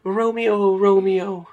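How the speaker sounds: high, drawn-out theatrical call; spouting stage Juliet. Acted. Woman spouts lines of the play to her co-star, theatrical. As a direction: declaim it like a stage heroine. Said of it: "she is just spouting off".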